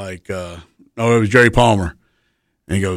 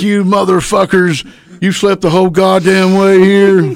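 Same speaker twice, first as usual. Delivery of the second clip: mock angry wife yelling, pitched up and louder; wife cussing them out; 9 semitones higher, 4 dB louder than usual. Wife chews out the guys who slept the whole drive, mock yelling. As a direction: play the furious wife tearing into sleepy passengers. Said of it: "she's gonna cuss me out".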